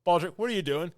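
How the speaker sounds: high, loud, impatient call to a servant; officer calling out to his servant, mock irritated; exasperated officer. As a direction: snap the question at him. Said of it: "And he goes".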